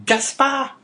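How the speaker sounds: high rising call of "Gaspard"; crying out. A narrator's reading. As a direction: call his name out in alarm. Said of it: "cried La Carconte".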